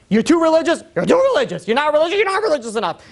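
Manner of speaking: high-pitched, rapid-fire mock complaints; complaining critics. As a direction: nag like people never satisfied. Voicing people's constant complaints.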